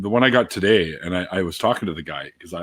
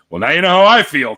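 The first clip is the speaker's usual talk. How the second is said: triumphant; 8 semitones higher, 8 dB louder than usual. Retold or imitated. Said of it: "the Seinfeld episode where he does that".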